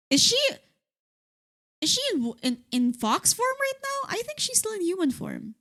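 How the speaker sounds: high-pitched eager questioning; excited. Real, not acted. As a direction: voice jumps up, curious and thrilled. Excited question about fox form.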